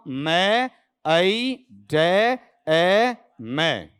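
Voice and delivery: मैं, आई, डे, ए sound by sound; phonetic spelling-out. Shown as a demonstration. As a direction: draw out each sound of मैं, आई, डे, ए separately. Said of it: "phonetically कैसा आएगा".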